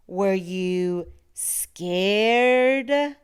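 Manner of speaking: slow, drawn-out loud spooky question; spooky monster tease. A narrator's reading. Monster Gabe teasing the child, slow spooky drawl. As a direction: stretch scared out to creep them.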